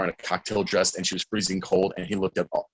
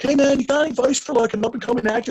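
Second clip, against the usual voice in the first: high, fast eager question; young fan asks Joey Lawrence for acting advice, eager; faster than usual. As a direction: ask like a nervous starstruck kid.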